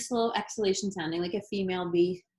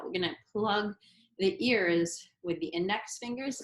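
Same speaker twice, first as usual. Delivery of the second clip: normal talking voice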